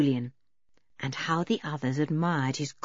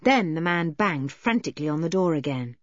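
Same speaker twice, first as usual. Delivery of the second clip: ordinary delivery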